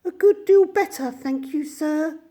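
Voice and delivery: high put-on weak old woman; feeble voice. A narrator's reading. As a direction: Toad faking a frail washerwoman. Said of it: "in a feeble voice".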